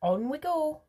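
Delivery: high, excitable candy maker; Willy Wonka voice. A narrator's reading. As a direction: squeak it out with glee.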